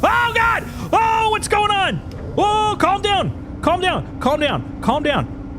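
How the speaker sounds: high, frantic yelling; panicked shrieking. Real, not acted. Vehicle tipping over, panicking.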